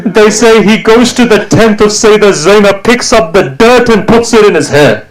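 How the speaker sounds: high, anguished majlis chant; lament recitation. Acted. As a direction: cry it out as a grieving reciter. Lament climax, turban thrown.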